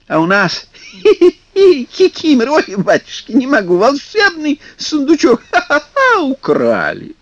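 high giggling through the words; laughing from tickling. Acted. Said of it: "смеясь от щекотки".

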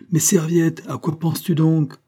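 appel plus haut et plus fort depuis la pièce voisine; un père malade qui appelle sa fille de loin; crié. A narrator's reading. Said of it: "cria de la pièce voisine". (le père crie à Marianne depuis l'autre pièce)

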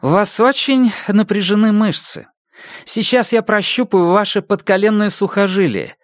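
brisk questioning doctor; female doctor voice. Acted.

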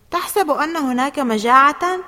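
high, loud exclamation; exclaiming. A narrator's reading. Exclaiming about the pupils shoving at the canteen. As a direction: call it out over a crowd.

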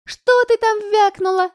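выше и громче, с вызовом; наехать на обидчицу; злой наезд. A narrator's reading. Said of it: "сказала Семакина". (Семакина угрожающе наезжает на Аню)

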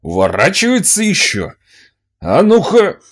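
high, loud furious yelling; angry shout. Acted. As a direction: rage at the dodging boy. Master raging at boy.

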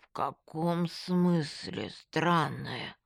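very slow, slurred question; wary and drawn-out, lip numb; numb-lipped friend. A narrator's reading. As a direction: drag the words out through a numb, crooked mouth.

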